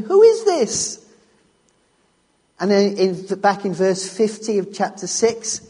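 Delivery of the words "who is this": much higher, slow, louder astonished cry; awed disbelief. Retold or imitated. Disciples in the boat, stunned by Jesus, high and slow. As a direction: stretch it out in stunned wonder. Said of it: "we left them asking".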